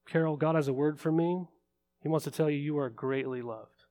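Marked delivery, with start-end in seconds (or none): 2.6-3.8 soft, intimate reassurance; tender assurance. Real, not acted. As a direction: hush down and speak it straight to one person. Personal word to congregant.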